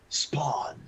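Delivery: unvoiced whisper. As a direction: breathe the name out. Whispered answer.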